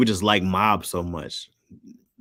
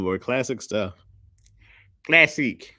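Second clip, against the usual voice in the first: ordinary talk, nothing marked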